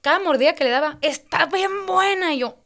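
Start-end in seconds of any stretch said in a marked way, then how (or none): 1.0-2.3 imitated yelling. The date yelling with every bite of pizza, drawn-out mock shout. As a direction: stretch each word like a shameless yell. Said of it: "empezó a gritar".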